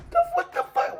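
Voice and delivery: very high squeaky what the fuck; shocked squeal. Real, not acted. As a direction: jump way up in total shock. Shocked reaction.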